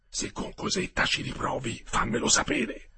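secret whisper, rising at the end; whispered in the ear. Acted. Olindo whispering into Metello's ear, conspiratorial whisper. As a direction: lean in and whisper a dare.

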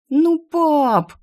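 high whiny plea; whining. A narrator's reading. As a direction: whine like a spoiled girl. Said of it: "заныла Инесса".

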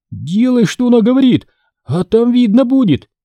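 put-on high, pleading boy's voice. A narrator's reading. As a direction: beg urgently in a high voice. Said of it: "взмолился Лори". (умоляющий мальчишеский голос)

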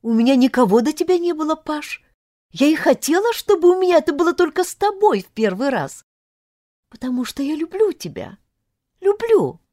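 high, fervent confession; lovestruck, earnest heroine. Acted. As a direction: pour out your love in a high, insistent voice. (влюблённая девушка, высокое горячее признание)

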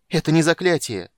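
high, loud cry of despair; desperate cry. A narrator's reading. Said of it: "отчаянно закричала Эмберил". (отчаянный крик)

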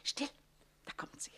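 whispered, urgent hush; hushed warning. Acted. To her ally as Susanne approaches, hushed whisper. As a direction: whisper so she won't hear you.

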